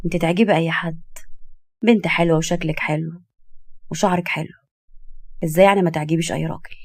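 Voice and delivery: restrained, soft-spoken seduction; smooth husband, complimenting. Acted. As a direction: flatter gently and evenly. Husband flatters wife.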